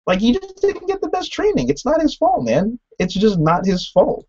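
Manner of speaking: high, exasperated defence of the squire. Real, not acted.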